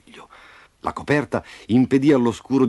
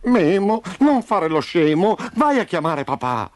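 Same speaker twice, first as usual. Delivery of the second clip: high, insistent scolding; exasperated plea; 8 semitones higher, 3 dB louder than usual. A narrator's reading. Trapped Roby telling Memo to fetch dad, exasperated. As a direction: scold your friend and send him off.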